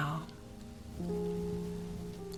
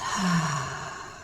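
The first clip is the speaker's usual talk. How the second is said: long breathy sigh out through the mouth; soft releasing exhale; 3 semitones lower than usual. Shown as a demonstration. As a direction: let the breath go in one slow, voiceless "haa". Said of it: "exhale through your mouth with a soft releasing sound".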